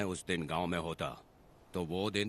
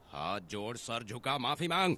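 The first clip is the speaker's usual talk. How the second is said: angry command; 3 semitones higher than usual. Acted. Villager makes the offender bow his head, commanding angrily. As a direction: climb in pitch with each order. Orders him to bow and apologise.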